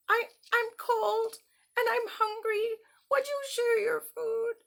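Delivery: high, pitiful begging voice; pleading grasshopper. Acted. Cold grasshopper begging the ants at their door. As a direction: beg for food up high. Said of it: "And the grasshopper said".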